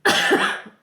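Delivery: sudden real cough; harsh single cough; loud cough. Real, not acted. Cough mid-intro.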